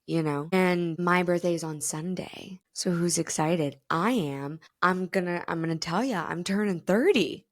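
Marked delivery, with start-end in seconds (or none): none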